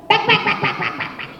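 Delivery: high bird call, fading to breathy clicks; mother bird shooing the rat away; bird alarm call. Acted. Mother bird warns off rat with high calls. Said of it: "she does".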